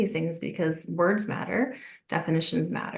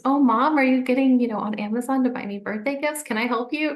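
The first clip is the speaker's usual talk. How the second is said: put-on higher, louder little girl voice; sweet, eager seven-year-old asking to help; eager child; 6 semitones higher, 5 dB louder than usual. Daughter to her working mom, eager child voice.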